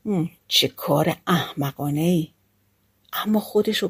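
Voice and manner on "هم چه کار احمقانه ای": low, soft grumble to oneself; muttering under the breath. A narrator's reading. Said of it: "زیر لب گفت".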